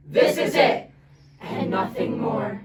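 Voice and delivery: usual speaking voice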